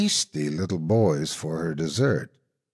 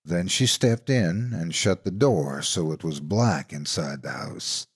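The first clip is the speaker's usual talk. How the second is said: usual speaking voice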